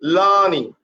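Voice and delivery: long LAA-ning for LEARNING, falling away; stress on first syllable. Shown as a demonstration. Teacher repeating the rule on stress, stressed LAA-ning. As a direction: press on the start of LEARNING. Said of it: "Put pressure on the first syllable".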